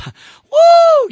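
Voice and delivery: high, loud joyful holler; preacher caught up in a shouting spell; ecstatic whoop. Real, not acted. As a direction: let out a sudden woo of praise. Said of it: "I'm having a shouting spell".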